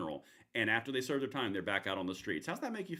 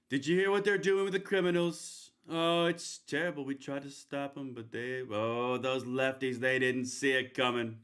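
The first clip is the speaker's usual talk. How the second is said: Trump impression. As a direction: ham up a Trump rally speech. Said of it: "let me do my best Trump impression".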